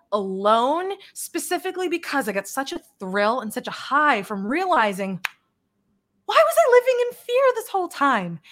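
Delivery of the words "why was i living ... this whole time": incredulous. Retold or imitated. Mock incredulous self-question.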